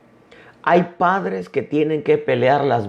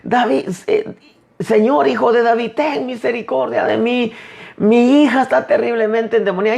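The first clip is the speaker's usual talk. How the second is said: higher, pleading cry of a desperate mother; 7 semitones higher than usual. Retold or imitated. Mother crying after Jesus for her daughter, higher pleading cry. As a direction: call out to the Lord as if running behind him. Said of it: "De ahí venía aquella mujer".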